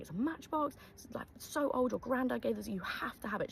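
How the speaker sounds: imitated high-pitched insistence; retold grandmother's eager insistence; adamant. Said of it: "She was so adamant".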